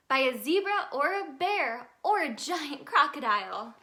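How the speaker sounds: plain reading voice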